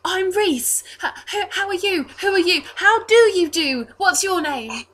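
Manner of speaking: put-on high, stammering, over-eager boy; Reese. Altar boy awkwardly introducing himself, high eager stumbling. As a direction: trip over the greetings in an excited child's voice. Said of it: "offers his left hand awkwardly".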